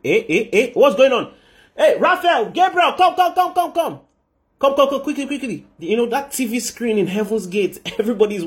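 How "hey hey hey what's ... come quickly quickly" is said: high, urgent summons, words tumbling out; frantic calling. Acted. Michael summons the angels to the screen, frantic calling. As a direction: call everyone over in a high-pitched panic.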